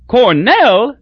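high, loud delighted cry; glad cry. A narrator's reading. Crosby hearing Newt went to Cornell, delighted cry. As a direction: burst out in happy recognition. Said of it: "cried Crosby gladly".

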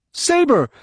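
very high, urgent cry; one high-pitched yell; echoing shout. A narrator's reading. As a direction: shout the finishing word. Droma echoes and finishes the shout.

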